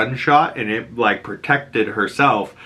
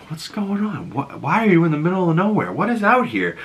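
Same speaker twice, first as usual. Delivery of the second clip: higher, puzzled nosy questions; confused delivery guy; 8 semitones higher than usual. Retold or imitated. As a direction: act the baffled Uber driver.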